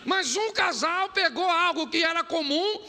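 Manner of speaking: high, loud preaching cry; fired-up sermon delivery. Acted. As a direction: drive the point home to the church. Preaching at full force.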